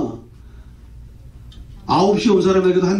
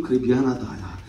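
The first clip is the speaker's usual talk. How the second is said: low, softened, conciliatory voice; kindly master soothing upset workers; apologetic; 6 semitones lower than usual. Acted. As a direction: gently appeal for sympathy. God as he ought to answer.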